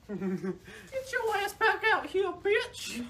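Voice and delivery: high-pitched laughing call; mock summons. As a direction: call them back in mock outrage. Laughing mock order shouted after someone.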